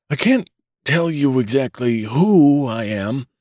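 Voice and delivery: a lightly voiced old man's high whine; querulous whine. A narrator's reading. Old newborn answering his father, lightly voiced querulous whine. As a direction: whine back like a fussy old man. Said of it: "replied the querulous whine".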